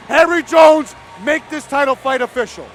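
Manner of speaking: high, loud hype call. Real, not acted. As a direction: shout the cue across the cage. Calling on the ring announcer.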